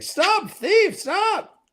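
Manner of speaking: high shouted cry after a thief; a character screaming at a fleeing thief, high and shouted; mock shout. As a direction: yell it after someone running off. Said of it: "Fael's like".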